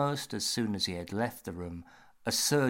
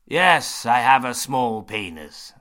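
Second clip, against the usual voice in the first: James voice; 3 semitones higher, 11 dB louder than usual. Acted.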